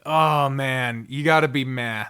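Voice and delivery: ordinary talk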